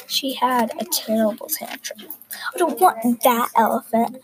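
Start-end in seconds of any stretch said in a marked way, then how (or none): none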